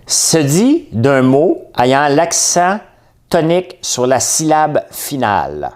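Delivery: very slow, high, loud dictation; spaced-out, projected game-host reading; dictionary definition read-out. Acted. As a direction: announce it slowly and emphatically, phrase by phrase.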